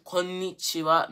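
"konnichiwa" with a long, stressed n; clearly doubled n in "konnichiwa"; emphasis on the n. Shown as a demonstration. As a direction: hold the n in "konnichiwa" before the ni. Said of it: "have the emphasis on the n".

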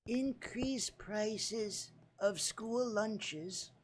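put-on small, soft old-lady voice; grandma in Congress. Grandma proposing cookies to Congress, soft high put-on voice.

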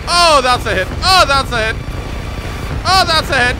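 loud, high cry on each hit; excited exclaiming. Real, not acted. Gamer landing hit after hit, excited exclaiming. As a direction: call out each hit with glee. Celebrating hits.

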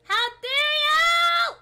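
high, very loud indignant cry; playful, hammed-up indignation at top volume; mock-outraged shout. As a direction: shout it with drawn-out theatrical outrage. Mock outrage at stepped-on crop.

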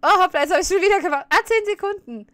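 high, loud and fast, caught off guard; startled outburst. Real, not acted. Streamer yelping at his own timeout mistake, loud and fast. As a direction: blurt it out in real surprise. Streamer accidentally times out chat again.

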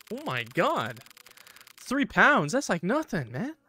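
high-pitched, swooping disbelief; amazed. Real, not acted. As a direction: marvel at a bargain, voice climbing. Amazed at low price.

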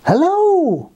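high, drawn-out calling hello; long hello that arches high and falls; loud cry. Acted. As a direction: call far across the forest. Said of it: "he cried loudly".

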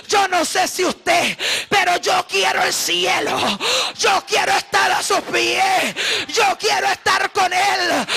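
fiery Spanish preaching; preaching at full force. Acted. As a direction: pour it out like a revival sermon.

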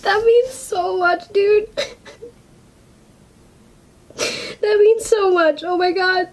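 real tearful, high, choked-up voice; slow and high, overwhelmed with happy tears; crying. Real, not acted. Viewer moved by what it means, crying. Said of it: "I'm gonna keep crying".

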